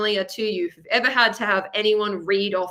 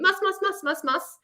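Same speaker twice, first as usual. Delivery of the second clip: pitched way up on the repeats; emphatic repeat; 10 semitones higher than usual. Real, not acted. Teacher stresses the musts, pitched high. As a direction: stack the musts higher and higher. Insisting repetition.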